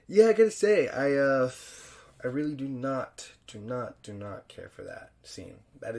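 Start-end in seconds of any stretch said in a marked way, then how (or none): 0.1-1.5 much higher, louder exclaim; sudden outburst. Real, not acted. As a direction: blurt it out, worked up. Sudden burst about scene.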